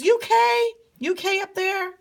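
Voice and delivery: very high-pitched call upward. Acted. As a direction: call out high to someone far away. King calls up to friend.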